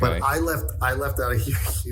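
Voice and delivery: ordinary delivery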